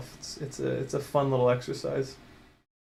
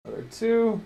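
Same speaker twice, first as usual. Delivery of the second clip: ordinary talk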